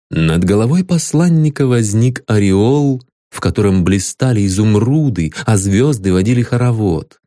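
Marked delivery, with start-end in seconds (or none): none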